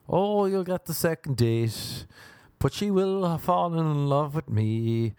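smug tease. Retold or imitated. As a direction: drawl it out gloating. Smug reply to friend.

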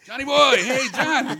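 high, imitated panicked shout for a friend; panicky calling. Calling for the friend hiding behind the couch, mock panic. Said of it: "hear the panic start in his voice".

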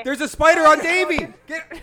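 high panicked yell; screaming at a spider. Acted. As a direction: shriek it like there's a spider on you. Prank callers panic over spider.

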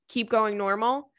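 ordinary talk

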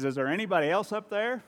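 high-pitched hopeful call; mock plea climbing high for the punchline; comic plea upward. Hanging man seeks another rescuer, high comic call. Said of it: "looks back up and says".

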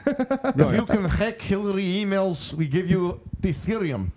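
slow mock Russian accent; hammed-up Russian villain, slowly. Mock Putin voice continues.

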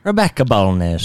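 jokey attempt at an Irish accent; playful mock-Irish name. Shown as a demonstration. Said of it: "to say in an Irish accent".

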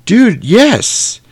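eager burst of recognition; excited. Real, not acted. Eager agreement about Broadway show.

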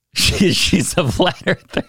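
laughing. Real, not acted. Laughing at her flat earth denial.

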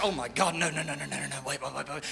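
a hushed, rising panic that fades away; mock panic. Church panicking over falling numbers, low and fading.

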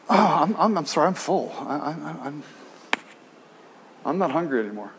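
soft, low, halting apology; sheepish refusal. Acted. Churchgoers turning down Christ's banquet, sheepish. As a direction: trail off haltingly while begging off.

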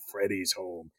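low, ominous villain line; menacing growl. Retold or imitated. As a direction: growl it like a slasher. Freddy puts on the glove.

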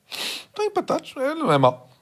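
high mocking squeak; mock surprised. Mocking rival club's goal tally.

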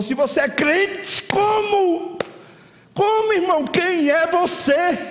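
high-pitched preaching climax, drawn out; impassioned challenge. Real, not acted. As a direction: build to a high, stretched cry. Preacher builds to a heated challenge.

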